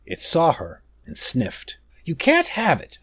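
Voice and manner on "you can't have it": exclaiming. A narrator's reading. Girl defies the wild guar, exclaiming with a raised pitch. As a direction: stand your ground against the beast, voice lifted. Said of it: "Iori exclaimed".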